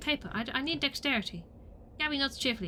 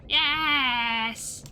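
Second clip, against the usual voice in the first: loud, long-held cheer; gleeful drawn-out celebration; triumphant yes; 3 semitones higher, 7 dB louder than usual. Pretend. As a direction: draw it out in victory. Celebrates gaining dexterity.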